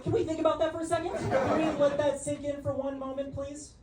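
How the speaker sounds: frantic mock plea, high and fast; pleading disbelief. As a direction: beg her to stop and think, hammed up. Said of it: "I was like".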